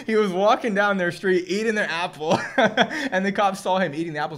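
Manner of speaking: high, loud, laughing through it; laughing narration. Real, not acted. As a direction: tell it while cracking up. Teller laughing at punchline.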